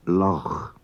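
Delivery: "loch" ending in a throaty Scottish CH. Shown as a demonstration. Teacher explains the Manx CH sound, Scottish CH demonstrated. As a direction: say "loch" with the back-of-throat fricative, not a K. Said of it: "as the C H in Scottish".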